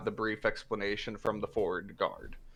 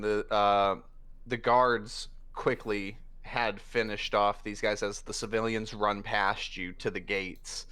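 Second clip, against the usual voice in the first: ordinary delivery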